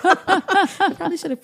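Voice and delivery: high, said through laughter; sheepish giggly confession; laughing admission. Real, not acted. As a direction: admit it while laughing.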